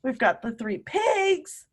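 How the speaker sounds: normal talking voice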